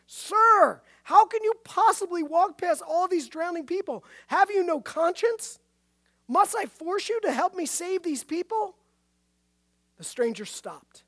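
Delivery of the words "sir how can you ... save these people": indignant, high-pitched shouted challenge; loud demand. A narrator's reading. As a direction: confront him loudly, outraged and pitched high. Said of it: "in a loud voice demanded".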